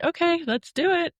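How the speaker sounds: sudden high, chirpy forced brightness; squeaky put-on bravery; mock brave okay. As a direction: fake cheer over real terror. Terrified patient faking brave readiness.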